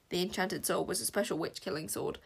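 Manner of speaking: usual speaking voice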